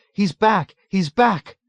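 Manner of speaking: very high, loud hysterical cry; scream. A narrator's reading. Nurse sinking into her colleague's arms, screaming. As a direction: scream it in distress. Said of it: "Nurse Kramer screamed".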